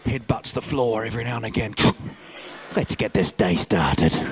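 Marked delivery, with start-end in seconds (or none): 2.8-4.2 low, slow mock toddler resolve; deep, deliberate imitation of a determined kid; little son's voice. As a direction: lower the voice and take it slowly.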